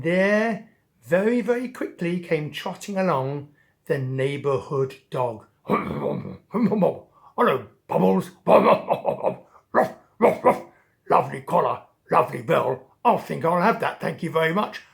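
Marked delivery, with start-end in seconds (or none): 5.7-14.8 loud, gruff-playful bully dog voice; taunting dog. Acted. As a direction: tease like a smug neighborhood dog. Dog taunts Bubbles, takes collar.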